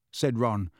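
plain reading voice

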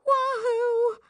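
very high, drawn-out whoop; elated whoop. Acted. Girl thrilled by her boyfriend's call, whooping. Delighted that he was about to call.

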